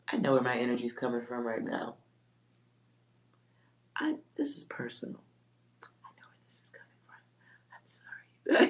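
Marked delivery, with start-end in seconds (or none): 4.0-8.7 hushed realisation. Real, not acted.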